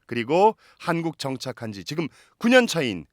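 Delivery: announcer voice. Acted. Host introduces a guest.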